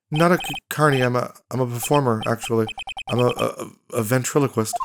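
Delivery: soft, stammering timid performer; nervous ventriloquist. Acted. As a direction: stumble shyly over the words. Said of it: "This guy's so nervous".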